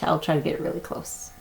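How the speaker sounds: hushed whisper. A narrator's reading. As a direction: sneak closer without a sound. Sneaking near the nests.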